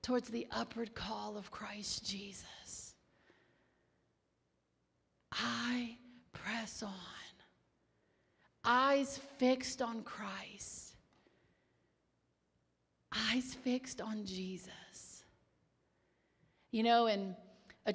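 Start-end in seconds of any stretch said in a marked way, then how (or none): 0.0-2.9 hushed, prayerful whisper, half the level; soft devotional whisper. Real, not acted. 5.3-15.2 slow, hushed prayerful whisper. Real, not acted.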